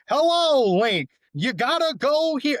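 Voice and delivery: high, loud, stretched-out impression voice; exaggerated, drawling high-pitched caricature; David Lynch. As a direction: call it out high and slow, drawing out the vowels. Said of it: "I could do a really good David Lynch impression".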